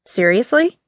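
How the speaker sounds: rising, incredulous "Seriously?"; disbelieving question. Acted.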